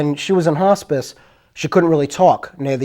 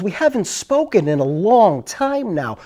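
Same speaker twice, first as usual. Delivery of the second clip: slow, higher-pitched mimicry of a clear-voiced woman; imitated polite caller; 4 semitones higher than usual. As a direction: draw it out politely, like a caller from long ago. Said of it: "the most clearest voice".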